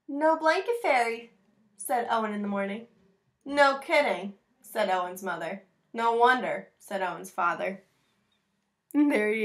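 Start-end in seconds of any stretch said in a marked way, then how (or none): 0.1-7.8 put-on reading to children. Reads picture book aloud.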